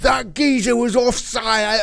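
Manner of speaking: slow, high mock Cockney fuming; imitating Vinnie Jones, Cockney and slow; Cockney accent. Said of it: "with his Cockney accent".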